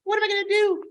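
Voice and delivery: high, fast panicked owner voice; Mary Lynn's lament over the dogs, in a high frantic voice; frazzled Mary Lynn. Acted. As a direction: fret like she's overrun with dogs. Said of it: "She's like".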